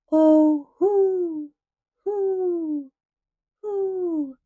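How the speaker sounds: high hooting calls to the echoes; boy hooting across the river. A narrator's reading. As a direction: call out hoo to hear it echo back. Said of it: "went Paul on the dike".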